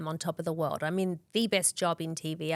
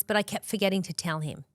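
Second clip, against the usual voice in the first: ordinary talk, nothing marked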